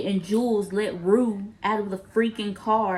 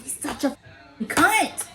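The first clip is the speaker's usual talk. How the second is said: higher, drawn-out mock outburst; retold outburst, pitched up; mock outraged insult; 6 semitones higher, slower than usual. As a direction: spit the insult out slowly, hammed-up. Acting out angry scene insult.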